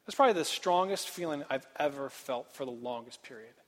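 ordinary delivery

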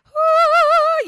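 a high, loud whoop of wonder; soaring exclaim of amazement; excited whoo. Pretend. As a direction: let out a big joyful whoo. Heavens opening, imagined.

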